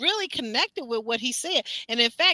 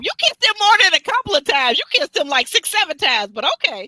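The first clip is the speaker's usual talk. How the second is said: higher and louder, incredulous; exasperated retort; 6 semitones higher, 8 dB louder than usual. Retold or imitated. Host mocking the woman's confession, loud and high. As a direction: call out the lie loudly. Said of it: "I'm thinking to myself".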